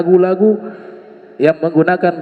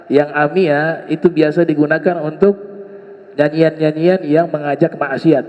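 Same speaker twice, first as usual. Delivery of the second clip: normal talking voice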